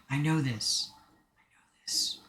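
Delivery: whispered to oneself. Real, not acted. Streamer muttering to self while trying to recall the instrument's name, whispered. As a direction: whisper it while searching your memory.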